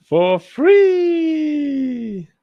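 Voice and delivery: high, loud, drawn-out squeal on free; gleeful squeal. Pretend. Streamer showing Robert a free find, gleeful high squeal. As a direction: crow it way up high, stretching the last word.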